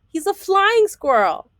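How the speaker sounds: high, slow storybook reveal; excited reveal. Acted. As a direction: make the big surprise land for the kids. Story reveal, squirrel glides.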